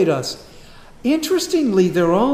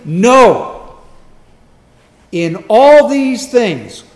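"No" hit very loud, then "all" stretched out; loud, slow, high stress on "No" and "all"; emphatic; 6 semitones higher, 13 dB louder, much slower than usual. Retold or imitated. Preacher quoting Paul's answer to suffering, emphatic "No" and drawn-out "all". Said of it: "he says emphatically".

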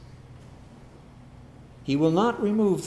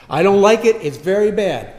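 much louder, whining protest; childish complaint; 3 semitones higher, 11 dB louder than usual. Pretend. Believer grumbling to God about hardship, loud whine.

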